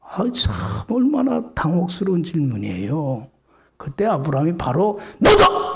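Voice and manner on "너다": sudden high shout of the answer; blunt shout. Shown as a demonstration. Abraham bluntly answering his son, sudden high shout. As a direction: blurt it out like a jolt. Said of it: "이렇게 얘기할 수 있어".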